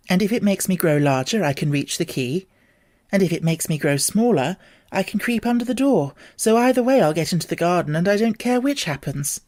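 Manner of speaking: put-on a girl talking herself into it; young Alice, plucky and sure; determined Alice. Alice reasons aloud.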